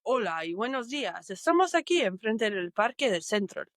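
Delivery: a Spanish news reporter presenting in Spanish; showing off a Spanish reporter voice for a friend. Shown as a demonstration. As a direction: read it like a Spanish TV reporter on location. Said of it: "let's hear the Spanish accent first".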